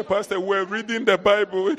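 high, whiny mock plea; imitated pleading church member. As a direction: whine an excuse to the pastor.

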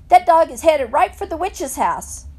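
loud, high-pitched alarm; hollered warning. A narrator's reading. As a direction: holler the danger after them. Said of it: "Dunlap hollered".

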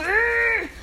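strained grunt of effort; mock struggle grunt; effortful groan. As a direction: groan like lifting something heavy. Abandoning sin was like a struggle.

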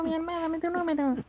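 ordinary talk, nothing marked

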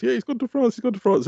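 high, lightly voiced child; son's voice. Father imitates son insisting hamster went to France, high child voice. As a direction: chirp it like the kid.

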